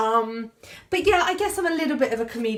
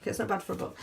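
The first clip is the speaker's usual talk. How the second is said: quiet aside; 8 dB quieter, faster than usual. Real, not acted. Author reassuring viewers about the book's price, softly as an aside. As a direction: drop it to a softer aside. Repeats the selling line as aside.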